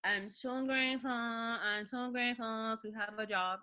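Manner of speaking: sing-song fake gratitude, slowed; mock grateful. Mocking her own daily grateful speech. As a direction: parrot the line with mocking sweetness. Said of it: "I say this shit every day".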